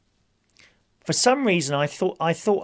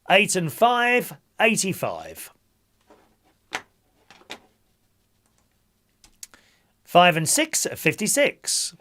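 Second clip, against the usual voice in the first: bingo caller; 4 semitones higher, 5 dB louder than usual. Acted. Calls bingo numbers.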